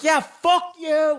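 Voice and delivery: high, slow mock yell back; yelling back at the stranger, high and slow; retold yell. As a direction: stretch "Yeah, fuck you" up high. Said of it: "I'm just fucking yelling at him".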